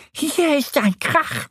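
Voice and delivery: very high, drawn-out squeaky duck; high cartoon duck voice; duck character voice. A narrator's reading. Duck Annie's character voice in story.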